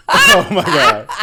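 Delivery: laughing out loud. Real, not acted. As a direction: burst out laughing. Hearty laugh at the joke.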